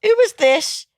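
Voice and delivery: who the hell is this, offended; outraged disbelief. Real, not acted. Reacting to an insulting clip.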